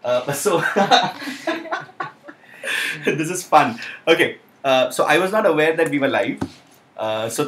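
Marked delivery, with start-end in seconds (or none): 0.6-2.3 long, high-pitched laugh; amused laugh. Real, not acted. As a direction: let out a real, high giggle. Amused at being live unawares.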